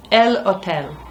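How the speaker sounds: "El hotel" with no h sound, stress on the last syllable; Spanish hotel, silent h. Shown as a demonstration. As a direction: drop the h: "El hotel" as o-TEL. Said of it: "Just don't say the h".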